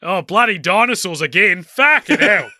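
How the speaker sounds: put-on loud, exasperated New Zealand accent rising to a yell; fed up Kiwi grumbling at dinosaurs; Alan Grant impression. Host answers the impression request with an angry New Zealand accent. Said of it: "do your best Doctor Alan Grant impression".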